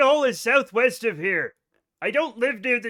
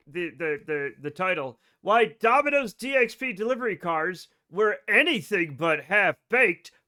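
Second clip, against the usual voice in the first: usual speaking voice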